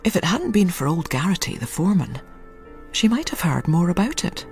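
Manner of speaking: ordinary talk, nothing marked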